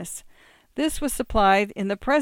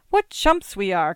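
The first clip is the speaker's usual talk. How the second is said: louder, sudden bright-idea exclamation; inspired cry; 6 dB louder than usual. A narrator's reading. Angela hits on a better place for the test, inspired cry. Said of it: "cried Angela, inspired".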